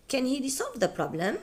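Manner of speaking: "Can he resolve the problem?" rising high at the end; rising question. Shown as a demonstration. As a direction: let the question climb upward. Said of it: "the intonation goes up".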